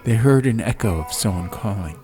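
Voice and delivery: plain reading voice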